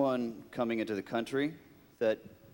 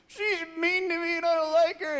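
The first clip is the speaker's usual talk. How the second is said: put-on higher, childish whine; mock whine; 4 dB louder than usual. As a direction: complain like a sulking child. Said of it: "I'm like".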